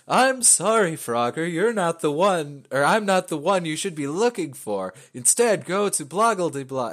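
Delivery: high, loud, lilting apology; hammed-up game princess, pitched well up; mock apologetic princess. Mock princess turning Frogger away.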